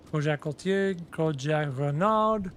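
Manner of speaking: ordinary talk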